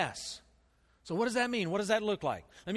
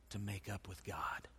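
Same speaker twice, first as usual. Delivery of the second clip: whispered; 9 semitones lower, 12 dB quieter than usual. Acted. Pastor drops voice on key point.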